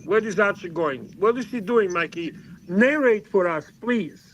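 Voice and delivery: ordinary talk, nothing marked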